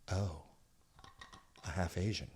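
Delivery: a hushed, let-down oh; deflated mock reaction. Everyone's disappointed reaction voiced.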